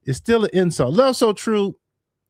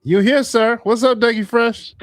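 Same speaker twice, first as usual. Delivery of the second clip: higher, louder hype greeting; excited greeting; 9 semitones higher, 5 dB louder than usual. Real, not acted. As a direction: greet him like a show host. Host greeting guest on show.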